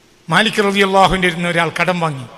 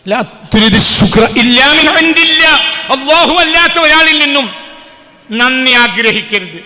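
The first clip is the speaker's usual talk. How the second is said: loud recitation; 6 semitones higher, 10 dB louder than usual. Shown as a demonstration. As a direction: recite it loud and high, pushing each word. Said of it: "എന്ത്, എന്ത്?". (ഉറക്കെ, ഉയർന്ന ശബ്ദത്തിൽ ചൊല്ലൽ)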